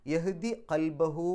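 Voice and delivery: very slow chanted യഹ്ദി ഖൽബഹു; Quranic recitation. Shown as a demonstration. Teacher chants the verse slowly for the class. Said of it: "recites verse for class".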